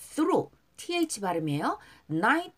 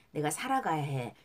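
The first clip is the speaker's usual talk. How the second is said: usual speaking voice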